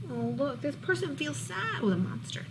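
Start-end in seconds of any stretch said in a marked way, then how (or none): none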